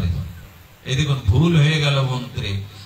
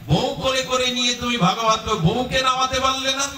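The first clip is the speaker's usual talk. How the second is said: much higher, taunting question; mocking jibe; 9 semitones higher than usual. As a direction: needle him in front of everyone. Chitraketu mocks Shiva publicly.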